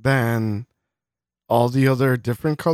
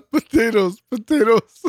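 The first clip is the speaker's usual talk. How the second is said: laughing echo; 9 semitones higher, 3 dB louder than usual. Real, not acted. Laughing, echoing the punchline.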